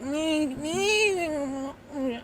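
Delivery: soft, high mock baby cry; baby whimper crying. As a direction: whimper like a fussy toddler. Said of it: "You're like".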